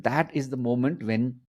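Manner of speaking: ordinary talk, nothing marked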